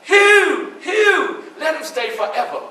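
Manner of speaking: imagining her begging for him; hoped-for plea. Retold or imitated. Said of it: "But I was waiting on".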